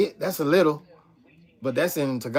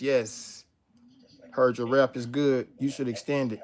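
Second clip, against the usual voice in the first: ordinary talk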